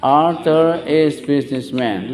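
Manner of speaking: slow, drawn-out teacher's model sentence; repeat-after-me model. Shown as a demonstration. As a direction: stretch each word for the class to repeat.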